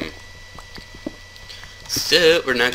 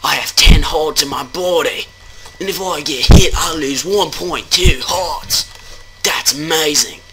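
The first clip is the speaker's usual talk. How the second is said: high, loud, hammed-up Australian accent; bad Australian accent; 8 semitones higher, 6 dB louder than usual. Player explaining his hearts in Minecraft, bad Australian accent. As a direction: marvel in a mock Australian accent. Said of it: "I'm not really good with my accents".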